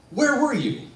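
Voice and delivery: high, loud, accusing question; angry demand. Retold or imitated. Stage manager confronting late actor before cast, angry. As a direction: call him out before everyone.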